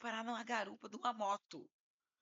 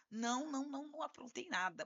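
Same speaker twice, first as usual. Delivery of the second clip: higher-pitched mock protest of innocence; protesting denial; 5 semitones higher, 5 dB quieter than usual. Client protesting innocence to his lawyer, mock indignant denial. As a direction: imitate a client denying it all.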